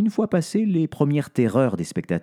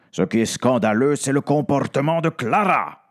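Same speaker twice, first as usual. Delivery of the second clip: shouting; 4 semitones higher, 3 dB louder than usual. Acted. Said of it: "hurle plus fort Victor Gratouille".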